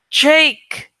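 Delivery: loud urgent call, dropping at the end; yelled. A narrator's reading. Narrator calls to Jake, loud yell. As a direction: shout his name to get his help. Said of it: "I yelled".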